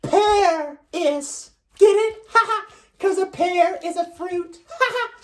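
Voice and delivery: high puppet voice cackling at the pun; laughing at own joke. Acted. Evelyn laughing at her own fruit joke to Bookworm, high giggling voice. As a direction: giggle through the punchline, very high.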